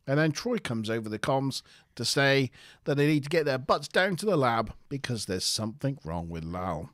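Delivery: usual speaking voice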